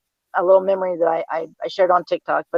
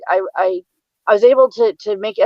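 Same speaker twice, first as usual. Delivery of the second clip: ordinary talk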